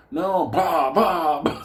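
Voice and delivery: high, loud vocal bangs; shouted bang sounds; imitated gunfire. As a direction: mimic rifle shots. Imitating shots.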